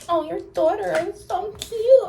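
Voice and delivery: mock a guy sweet-talking her. Single mom mimicking a guy flattering her daughter, high and sugary. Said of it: "butter me up and be like".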